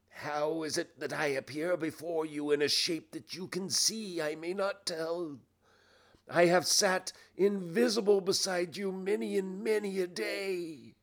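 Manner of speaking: solemn ghost. Acted. Ghost reveals its unseen presence to Scrooge, solemn. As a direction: intone it mysteriously, rising in intensity.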